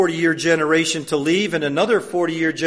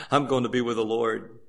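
chuckling, softer reply with a laugh; laughing Moses; 4 semitones lower, 6 dB quieter than usual. Retold or imitated. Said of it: "He'd say".

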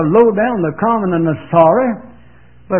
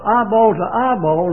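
ordinary talk

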